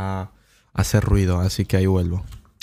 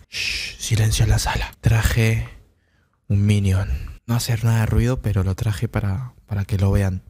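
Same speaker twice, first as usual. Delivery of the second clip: usual speaking voice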